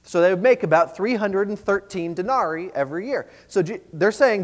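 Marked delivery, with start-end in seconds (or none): none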